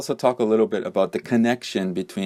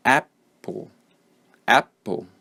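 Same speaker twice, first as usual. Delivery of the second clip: "apple" as AP-pul, strong start, weak falling end; first-syllable stress; 3 semitones higher, slower than usual. Shown as a demonstration. Teacher models the word for learners, first-syllable stress on "apple". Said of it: "The first syllable is stressed".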